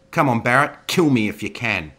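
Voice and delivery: loud goading challenge; taunting. Acted. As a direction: dare him to come at you. Zoe goads Roy to attack.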